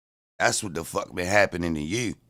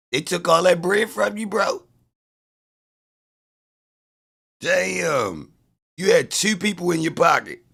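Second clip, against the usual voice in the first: mocking taunt; 6 semitones higher than usual. Real, not acted. Mocking a chat user.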